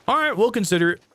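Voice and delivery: high, brushing-off parent tone; dismissive. Real, not acted. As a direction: say it like a polite brush-off. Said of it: "The way you said that".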